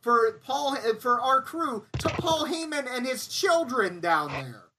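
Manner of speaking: half-laughing fury at the prank; accusing Kenny. Retold or imitated. Crew guy accusing Kenny of the rib, laughing and high. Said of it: "And I go".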